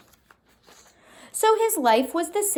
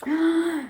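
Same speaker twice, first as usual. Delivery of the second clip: surprised gasp; 7 semitones higher than usual. A narrator's reading. Reader hears worrying sound.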